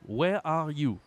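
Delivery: ordinary delivery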